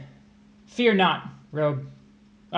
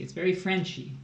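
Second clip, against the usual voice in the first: ordinary delivery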